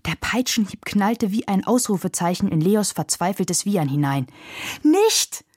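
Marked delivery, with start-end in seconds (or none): 4.8-5.4 high, sudden shout; alarmed cry. A narrator's reading. Carlos protests as Leo is whipped, crying out. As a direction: cry out to stop a blow. Boy stops coachman beating horse.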